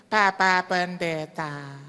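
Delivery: put-on high, slow old lady voice; lightly voiced elderly widow; old woman caller. Old widow phoning the pastor, high and drawn out.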